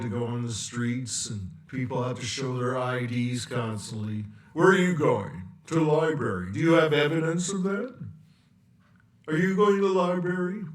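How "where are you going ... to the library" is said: loud, fast police questioning; acted checkpoint officer, louder; interrogating cop. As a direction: bark questions at a pedestrian.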